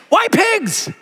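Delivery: can't believe it; incredulous. Real, not acted. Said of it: "pastor's own disbelief".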